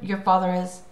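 ordinary delivery, nothing marked

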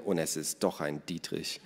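low, soft grumble; quiet, stubborn aside; murmured. A narrator's reading. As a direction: mutter it under your breath.

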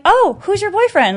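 high, bright-eyed innocent question; mock innocent. To the girl blocking the bathroom, mock innocent and high. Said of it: "I'm the most innocent person".

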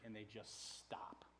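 slow, low near-whisper; hushed awe. Real, not acted. Preacher telling how wind and water went still, hushed. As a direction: let it drop away into stillness.

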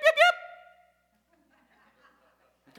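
very high, loud yipping call; shepherd's call. Shown as a demonstration. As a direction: call the sheep with a shrill-free high yip, laughing through it. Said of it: "they would go".

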